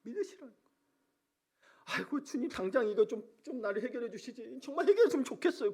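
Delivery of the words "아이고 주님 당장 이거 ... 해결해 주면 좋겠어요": lightly voiced higher-pitched plea to God; mock pleading, pitched up; pleading prayer. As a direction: plead like a believer wanting help now.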